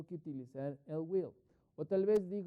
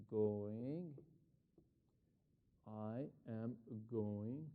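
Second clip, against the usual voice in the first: each word spoken slowly and separately with long pauses; slow dictation; 5 semitones lower, 8 dB quieter, much slower than usual. Shown as a demonstration. Teacher dictating a plan to students, slow and separate. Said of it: "Y digo".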